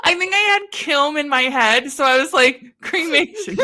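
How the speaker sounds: real giggles taking over the words; laughing at own slip. Real, not acted. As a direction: explain it while cracking up.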